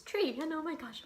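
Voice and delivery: real catch of emotion, pitch jumping high then settling; tearing up. Real, not acted. Woman telling viewers about a tree bought for a dad who died, tearing up. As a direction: get choked up mid-story and own up to it. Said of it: "I'm crying now".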